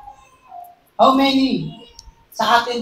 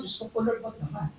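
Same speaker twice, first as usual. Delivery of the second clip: half-joking plea; 13 dB quieter, faster than usual. Pretend. Preacher joking to the church about sickness, soft plea. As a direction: mutter it like a scared joke. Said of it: "Ako po personally".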